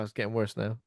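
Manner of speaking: ordinary talk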